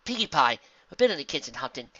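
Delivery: usual speaking voice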